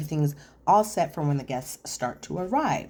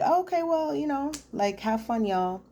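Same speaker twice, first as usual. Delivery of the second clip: high, fake-breezy Oh okay have fun; a put-on breezy goodbye; mock hurt brush-off; 9 semitones higher than usual. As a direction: pitch it up like hiding hurt. Joellen hurt, not invited.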